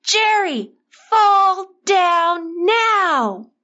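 high, long-drawn shouted command; loud shout. A narrator's reading. Father shouting to his son in danger, urgent drawn-out shout. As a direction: shout slowly across a distance, stretching each word. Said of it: "shouted as loud as he could".

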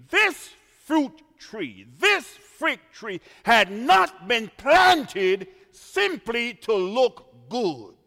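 building pulpit cadence; preaching emphasis. Acted. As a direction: lean into each phrase. Preacher stresses the point.

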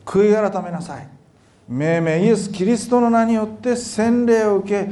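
preaching. Acted. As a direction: proclaim it slowly to the crowd.

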